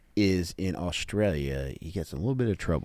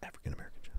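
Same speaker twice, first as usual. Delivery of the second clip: mock whisper; 16 dB quieter, much faster than usual. Teasing aside about the mom.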